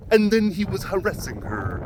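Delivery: over-enunciated journalist impression; Phil Williams. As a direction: mimic a reporter weirdly over-enunciating, slightly high. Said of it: "he would over-enunciate".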